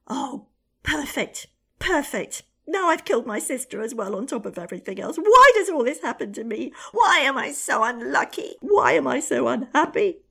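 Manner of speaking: high, loud wailing rant; queen shrieks in despair after killing her sister; frustrated screaming. Acted. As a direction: scream your bad luck at the ceiling. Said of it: "screamed with frustration".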